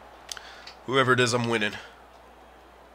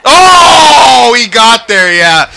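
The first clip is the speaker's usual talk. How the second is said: disappointed shout; 7 semitones higher, 9 dB louder, much slower than usual. Real, not acted. Shot saved in shootout.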